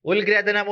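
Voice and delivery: ഉൽഗ്രഥനം with an l sound before the g; wrong l sound. Shown as a demonstration. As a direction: say ഉൽഗ്രഥനം as ul-grathanam. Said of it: "ഇതൊരിക്കലും പറയില്ല അല്ലെ?".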